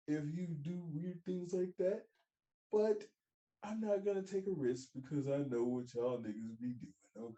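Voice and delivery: ordinary talk, nothing marked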